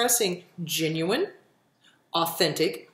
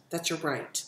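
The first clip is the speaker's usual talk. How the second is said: softer, low-pitched echo of "That's your right."; soft emphatic repeat; 4 semitones lower, 8 dB quieter than usual. Real, not acted. Repeats phrase softer second time.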